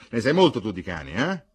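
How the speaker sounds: loud, fast mocking jab; sneering retort. A narrator's reading. Brother mocking his sister about dogs, loud sneer. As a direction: throw it back scornfully. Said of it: "rispose ghignando il fratello".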